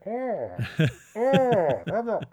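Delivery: high, drawn-out mock moans of agony; badly faked groaning in pain; Bane. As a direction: wail it high, hamming up the agony. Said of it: "who's in agony the whole time".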